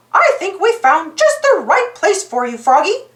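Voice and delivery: high, kindly cartoon-mouse voice; little hero proposing to help; Mickey Mouse voice. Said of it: "Mickey said".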